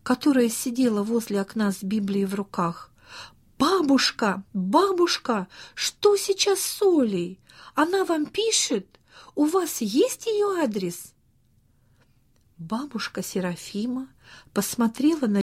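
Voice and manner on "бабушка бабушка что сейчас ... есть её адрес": put-on urgent, high-pitched children's questions; acted excited kids calling to grandmother; near-shouted questions. A narrator's reading. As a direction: breathlessly press grandma for news, pitch soaring. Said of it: "они почти прокричали бабушке Серафиме".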